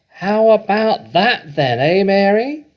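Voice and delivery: put-on high, slow, loud old grandma voice; a triumphant old woman calling down from above; Grandma shouting. A narrator's reading. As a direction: crow it down from the rooftop. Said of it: "Grandma shouted".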